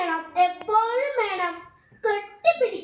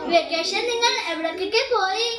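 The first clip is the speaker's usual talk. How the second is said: normal talking voice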